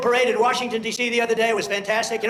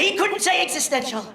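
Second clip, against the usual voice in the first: mocking the press; 8 semitones higher than usual. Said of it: "they're gonna say".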